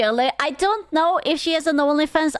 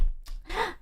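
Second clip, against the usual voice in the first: mock gasp; 8 semitones higher, 10 dB quieter than usual. Mock gasp in retold scene.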